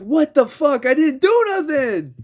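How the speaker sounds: high, loud indignant yell; exasperated, hammed-up cry of innocence; outraged protest. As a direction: shout it in disbelief, stretching the ending. Protesting after customer storms off.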